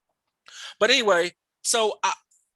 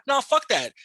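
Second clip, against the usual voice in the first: heated retold telling-off, pitch raised; angry rant; 3 semitones higher than usual. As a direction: re-enact chewing out coworkers over an insult. Retelling his angry outburst at work.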